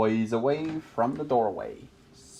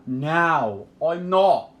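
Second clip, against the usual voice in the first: mild shout rising to a higher pitch; 4 semitones higher, 4 dB louder, much slower than usual. A narrator's reading. Princess refusing the idea of marriage, mildly shouted. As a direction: build to an indignant cry. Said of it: "she shouted".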